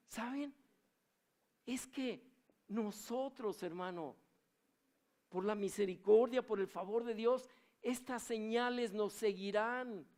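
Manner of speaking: high, loud impassioned sermon; fiery preaching. Acted. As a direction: preach it with full force to the church. Preaches at full force.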